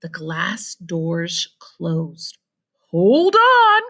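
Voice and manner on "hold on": loud, high cry of warning; cried out. A narrator's reading. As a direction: call out to brace them. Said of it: "cried Mister Wonka".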